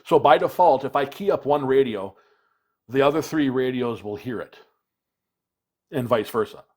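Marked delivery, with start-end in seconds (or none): none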